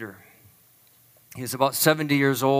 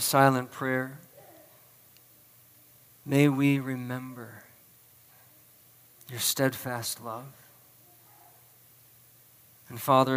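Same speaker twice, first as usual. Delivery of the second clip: solemn prayer. Real, not acted. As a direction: lead them in prayer unhurriedly, softening at the close. Leads the congregation in prayer.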